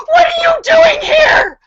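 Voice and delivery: high, loud, frantic shouted question; panicked yell. Retold or imitated. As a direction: scream at the strangers in panic. Yelling at strangers at door.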